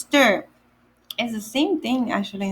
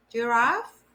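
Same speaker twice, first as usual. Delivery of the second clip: ordinary delivery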